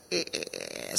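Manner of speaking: very low, soft creaking noise; imitated stretching sound. Telling what was heard from the boy's legs, imitated creak. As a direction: creak like rubber tubing pulled taut.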